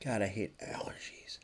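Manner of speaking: a private gripe whispered under the breath; whispered aside. Real, not acted. Streamer whispering a gripe about allergies.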